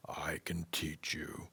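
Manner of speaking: soft, unvoiced wise tree voice; tree whispering a question to the boy. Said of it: "the tree offered".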